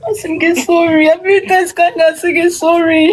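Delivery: 1 pleading apology. Acted. Girl begging the bishop.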